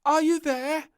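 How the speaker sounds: high, loud call. Acted. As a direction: call out to someone hiding. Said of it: "called out Little Princess".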